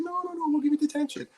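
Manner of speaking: high, fast mock voice of the teacher; squeaky parody of the teacher refusing; teacher mimicked, high and fast. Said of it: "She's like".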